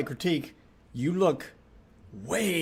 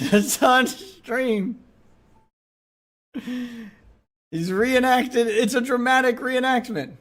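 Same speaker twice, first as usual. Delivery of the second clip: louder, higher, stretched slow with laughter; amused excitement; 10 semitones higher, 5 dB louder, much slower than usual. Real, not acted. Streamer amazed at his own stream reenacted, excited. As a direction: marvel at it, half laughing.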